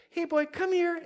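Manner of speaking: high, coaxing call to a dog. Retold or imitated. Owner calling his tangled dog over, high coaxing voice. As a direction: coax a pet over in a high voice.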